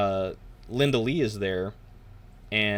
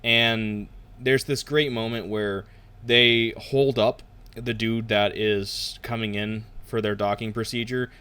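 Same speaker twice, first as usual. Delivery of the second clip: ordinary talk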